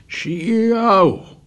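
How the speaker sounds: high, drawn-out swooping plane imitation; fighter plane noise. A narrator's reading. Ralph plays fighter plane machine-gunning Piggy, imitated swoop. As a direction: zoom like a diving plane at play. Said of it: "returned as a fighter plane".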